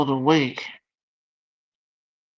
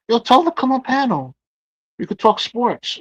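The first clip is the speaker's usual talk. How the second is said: excited urging; 8 semitones higher, 4 dB louder than usual. Real, not acted.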